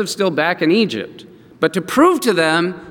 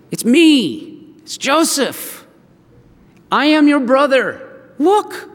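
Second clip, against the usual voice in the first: high, urgent plea to be recognized; emotional revelation; 8 semitones higher, slower than usual. Acted. Joseph revealing himself to his brothers, urgent revelation. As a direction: reveal it insistently, pitch way up.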